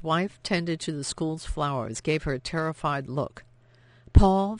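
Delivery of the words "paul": called out sharply and loud; sharp, louder call across the hallway. A narrator's reading. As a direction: snap his name to stop him. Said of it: "Vianne said sharply".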